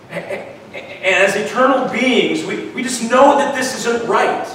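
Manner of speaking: a touch of rising preacher fervor; preaching. Acted. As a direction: build the sermon with passion. Preaching builds up.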